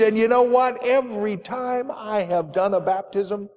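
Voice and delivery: ordinary delivery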